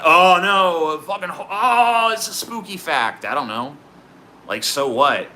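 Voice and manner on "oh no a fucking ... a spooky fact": much higher hammed-up gasp of fright; mock horror. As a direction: pretend to be spooked by a harmless fact. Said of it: "is it like".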